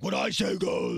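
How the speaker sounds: forceful mock rock-singer voice; imitating a sudden commanding shout. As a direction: belt it out in a strained put-on voice. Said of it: "his own Genesis voice".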